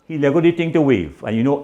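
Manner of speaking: ordinary delivery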